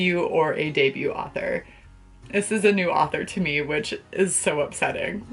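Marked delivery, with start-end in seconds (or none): none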